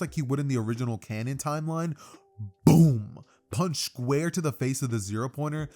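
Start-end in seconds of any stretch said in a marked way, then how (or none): 2.7-3.2 loud punched-out "boom"; booming impact. Acted. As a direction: shout it like a punch landing. Sound effect of the punch landing.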